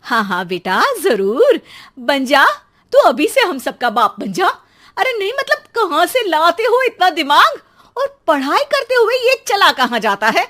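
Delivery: mock agreement turning into fury; mother mocking her cheeky son, then exploding; sarcastic scolding.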